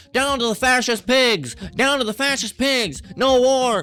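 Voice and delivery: high, strained parody chant; mock protest yell. Protesters out there yelling slogans, high mocking yell. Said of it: "you go out there and you yell that".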